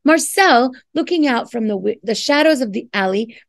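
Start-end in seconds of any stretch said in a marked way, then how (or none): none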